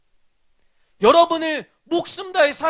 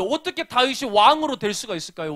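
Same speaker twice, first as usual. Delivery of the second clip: normal talking voice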